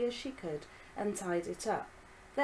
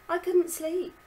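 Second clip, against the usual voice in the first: put-on higher girlish voice; young girl voice; 11 semitones higher, 7 dB louder than usual. Violet character voice.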